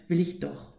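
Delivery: ordinary delivery, nothing marked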